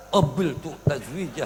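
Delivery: mock groom reciting, dragging out the guttural qaf in Qabiltu; heavy qaf. Preacher imitates groom's vow, stressing qaf in Qabiltu slowly. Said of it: "itu qafnya berasa".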